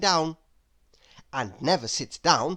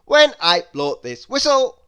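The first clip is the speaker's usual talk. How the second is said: loud, raised brisk voice; bossy Bustle; 5 semitones higher, 9 dB louder than usual. A narrator's reading. Mister Bustle loudly warning Lazy about the whistle. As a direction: lay down the rules firmly. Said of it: "said Mister Bustle".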